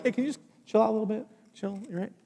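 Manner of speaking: fast, soft, offhand request; casual chill-out. Pretend. Imagined laid-back Jesus, casual soft request. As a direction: shrug it off like a buddy.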